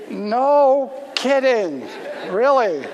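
slow, drawn-out laughing echo of no kidding; amused slow laughing reply. Retold or imitated. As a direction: toss it back laughing at his shock. Pastor echoes him, laughing.

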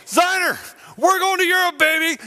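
very high, loud shouts of excitement; freaking out. Retold or imitated. Friend celebrating the fake winning ticket, excited shouting. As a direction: whoop it out like a lottery winner. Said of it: "he's all freaking out".

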